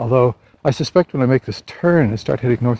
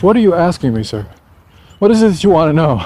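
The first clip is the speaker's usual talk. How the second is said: higher, louder mock questioning of a guest; imitated front desk clerk; 7 semitones higher, 5 dB louder than usual. Hotel clerk questioning a confused caller, imitated as higher and louder. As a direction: play a puzzled clerk pressing a caller. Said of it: "She asked me in English".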